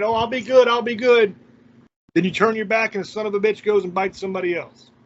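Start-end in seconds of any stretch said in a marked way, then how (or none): 0.0-0.7 mock sweet promise, higher and louder, rising at the end; hammed-up insincere pledge with a lift on "good"; fake promise. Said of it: "they'll pretend for a minute". 0.7-1.3 mock sweet promise, higher and louder, falling on "good"; hammed-up insincere pledge, settling down on "good"; fake promise. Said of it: "they'll pretend for a minute".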